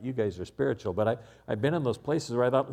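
ordinary talk

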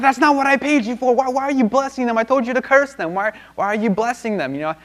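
high-pitched, frantic mock outrage; exasperated high-voiced acted protest; king freaking out. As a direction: rant in a hammed-up royal tantrum, easing off gradually. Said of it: "And the king freaks out".

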